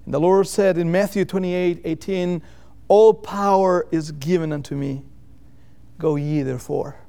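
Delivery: usual speaking voice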